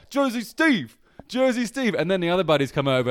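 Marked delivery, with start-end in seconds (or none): 0.0-1.9 put-on high-pitched Jersey accent calling out. Friend outing him by nickname, high Jersey accent. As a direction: heckle him in an imitated Jersey voice. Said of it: "he actually has a Jersey accent".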